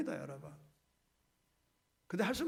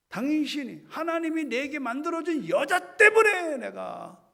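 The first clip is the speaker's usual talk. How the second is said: indignant blaming; 6 semitones higher, 7 dB louder than usual. Acted. Adam blaming, raised voice.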